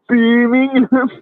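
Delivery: BIRMINGHAM drawn out high in imitated Brummie; teasing high-pitched imitation of Brummie "Birmingham"; mock Birmingham accent. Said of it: "as you pronounce it".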